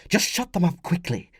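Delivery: high, urgent woman's voice; urgent hiss. Acted. Wife to husband, sleeping child, urgent hiss. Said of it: "Flavia hissed".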